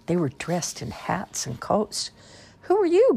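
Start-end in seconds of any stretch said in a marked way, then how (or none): 2.6-3.1 high, loud shocked question; gasping. A narrator's reading. Jerome meeting alligators in the closet, gasping. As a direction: gasp it in astonishment. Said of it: "gasped Jerome".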